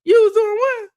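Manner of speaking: high, loud incredulous squawk; can't believe what she's hearing; outraged, stunned question; shocked disbelief. Real, not acted. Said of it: "reacting in disbelief".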